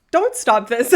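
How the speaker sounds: sudden loud, joking correction; loud reversal. Real, not acted. Streamer taking back the plea to gifters, loud reversal. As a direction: burst out loudly to take it back.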